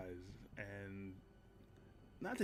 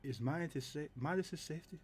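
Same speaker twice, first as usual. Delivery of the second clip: a touch higher, level and serious bodyguard; firm protective bodyguard, understated; Callow speaking plainly; 6 semitones higher, 5 dB quieter than usual. Acted. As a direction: speak plainly, quiet resolve.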